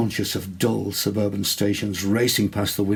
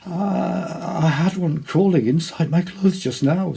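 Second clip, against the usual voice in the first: high, strained voice unlike his own; voice not his own; 9 semitones higher, 4 dB louder than usual. A narrator's reading. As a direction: squeeze it out, hardly himself. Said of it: "in a voice that hardly seemed his own".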